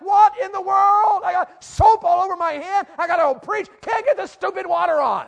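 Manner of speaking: high, loud acted exasperation; hammed-up frustrated outburst; frustrated shout. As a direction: yell it out at the end of your rope. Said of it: "Finally, I was frustrated".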